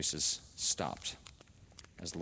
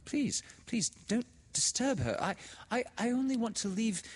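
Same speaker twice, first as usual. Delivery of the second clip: put-on high, stammering, anxious girl; Laura, flustered; 5 dB louder than usual. A narrator's reading. A frightened girl apologising to the widow's sister, flustered. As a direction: stumble over the words, panicked. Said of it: "said Laura".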